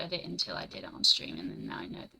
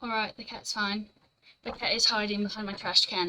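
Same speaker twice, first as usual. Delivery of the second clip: ordinary talk, nothing marked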